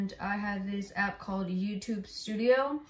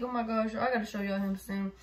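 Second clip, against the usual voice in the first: ordinary talk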